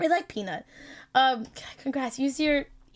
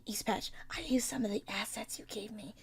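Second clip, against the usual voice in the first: whisper; 3 semitones lower, 12 dB quieter than usual. Real, not acted.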